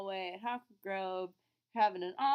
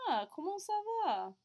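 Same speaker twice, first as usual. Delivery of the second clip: eager question; 10 semitones higher than usual. Retold or imitated. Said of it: "So you ask me".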